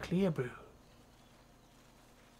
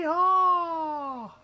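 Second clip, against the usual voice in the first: yeehaw yell; 8 dB louder than usual. Real, not acted. Jumping off ledge.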